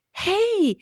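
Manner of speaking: calling out to a younger self with delight; eager high hello; excited greeting. Retold or imitated.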